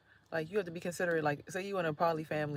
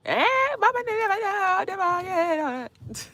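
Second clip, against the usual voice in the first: mock high call; 12 dB louder than usual.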